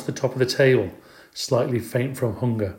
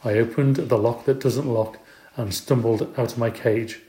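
ordinary delivery, nothing marked

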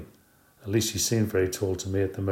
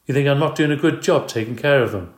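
higher, louder, stung challenge; husband bristling at her criticism; angry edge; 5 semitones higher, 7 dB louder than usual. Acted. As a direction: bristle at the insult. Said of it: "His voice had an angry edge".

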